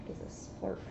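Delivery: whispered aside; whispering. Real, not acted. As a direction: breathe the question under your voice. Reader whispers aside to partner.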